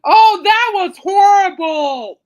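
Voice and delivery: loud, slow, high cry of disgust; drawn-out, appalled outcry; horrified shout. Real, not acted. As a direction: bellow it out, still grossed out. Recalling the gory scene.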